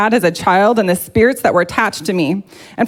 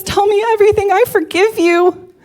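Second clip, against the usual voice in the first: tender forgiveness; 10 semitones higher than usual. Retold or imitated. Husband's forgiving words voiced.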